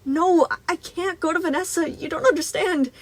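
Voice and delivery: high, loud pleading; panicked squeak. A narrator's reading. Boy begs not to see Vanessa, squeaking in panic. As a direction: squeak out a frantic plea. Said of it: "Gregory squeaked".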